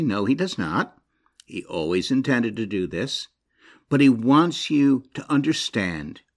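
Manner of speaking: usual speaking voice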